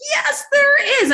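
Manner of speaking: very high, slow, ringing yes; slow, soaring, enthusiastic yes; excited agreement. Retold or imitated. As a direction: leap up in pitch, won over. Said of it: "I was like".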